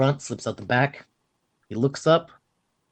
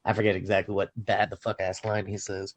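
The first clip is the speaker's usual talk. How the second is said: ordinary talk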